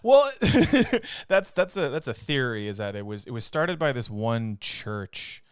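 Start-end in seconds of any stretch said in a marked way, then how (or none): 0.3-1.2 loud laugh. Real, not acted.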